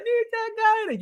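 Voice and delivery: high-pitched mock yell at normal volume; imitated excited scream. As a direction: imitate a fanboy shrieking the title, held back. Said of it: "He was screaming his lungs".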